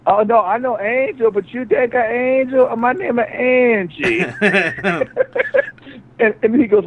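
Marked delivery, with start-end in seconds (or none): 0.1-4.3 high, slow put-on voice; mock waitress. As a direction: correct him like the muddled waitress.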